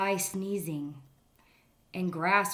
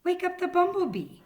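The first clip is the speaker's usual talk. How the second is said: high, louder squirrel urging; 12 semitones higher, 6 dB louder than usual. Acted. Squirrel urging the rabbit on, high squirrel voice. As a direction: pipe it up like an eager squirrel. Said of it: "said the squirrel".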